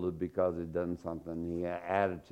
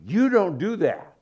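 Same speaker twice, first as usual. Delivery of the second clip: parental scolding; 8 semitones higher, 7 dB louder than usual. Retold or imitated. Parent scolding a child, voiced.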